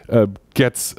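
usual speaking voice

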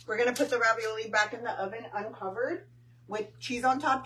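ordinary delivery

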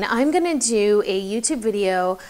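mock reading off note cards, monotone; boring read-out. Shown as a demonstration. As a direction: drone through it like a dull script. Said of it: "boring. It's so boring".

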